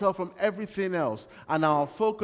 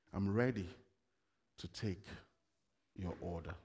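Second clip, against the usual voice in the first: much softer, fading to almost nothing; soft humble offer; 9 semitones lower, 10 dB quieter than usual. Retold or imitated. Believer quietly offering to serve the Lord. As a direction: offer yourself quietly like a servant. Humble servant posture before Jesus.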